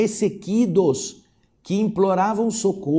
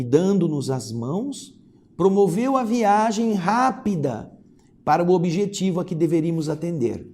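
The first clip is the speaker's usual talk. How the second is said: plain reading voice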